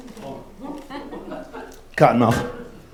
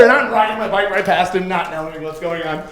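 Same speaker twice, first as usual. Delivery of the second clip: ordinary talk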